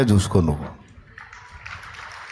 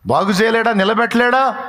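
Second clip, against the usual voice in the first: fervent preaching; 6 semitones higher than usual. Real, not acted. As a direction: build the challenge to full force. Preacher rising to climax.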